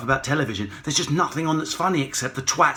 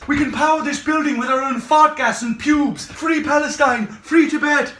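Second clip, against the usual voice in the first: much higher, chanting activist; hippy activist; 11 semitones higher, 3 dB louder than usual. Pretend. Mocking Green activist.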